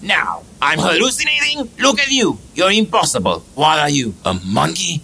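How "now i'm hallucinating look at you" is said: usual speaking voice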